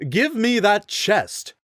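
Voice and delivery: high, slow haughty knight voice; pompous rider ordering. A narrator's reading. Rider to Link over the chest, high slow commanding. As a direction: demand it, high and drawn out. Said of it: "the rider ordered".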